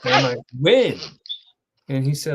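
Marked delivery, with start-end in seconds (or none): 0.6-1.0 short, higher, startled "when?"; shocked question. Retold or imitated. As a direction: ask it in sudden disbelief. Retelling hearing of a death.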